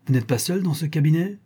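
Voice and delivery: high, insistent order through a door; commanding cry. A narrator's reading. Baron at his son's closed door, calling out an order. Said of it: "cria le baron".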